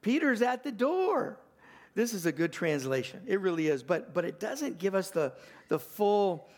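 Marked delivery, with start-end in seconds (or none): 0.0-1.4 excited repeat. Retold or imitated. Preacher relishing Rhoda's joyful cry, higher excited repeat. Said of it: "I love that".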